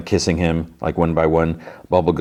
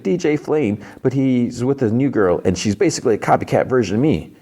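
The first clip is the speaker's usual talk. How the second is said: whiny upset voice; 6 semitones higher than usual. Retold or imitated. Drunk Fiona crying to Hunter.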